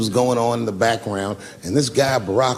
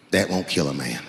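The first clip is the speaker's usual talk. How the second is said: deep gun clerk voice; 11 semitones lower, 4 dB quieter than usual. Retold or imitated. Gun clerk explaining birdshot to the comedian, deep deadpan voice. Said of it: "he goes just like this".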